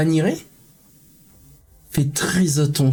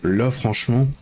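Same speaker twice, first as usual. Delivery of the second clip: tired; 5 semitones lower than usual. Real, not acted. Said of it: "je sais pas si ça se ressent".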